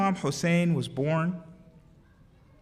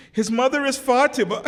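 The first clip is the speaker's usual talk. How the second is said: higher, louder, cracking into sobs; breaking into tears; 5 semitones higher, 5 dB louder than usual. Real, not acted. Preacher quoting the Prophet on Hussein's mother Fatima, breaking into tears. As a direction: let the name tip you into real weeping.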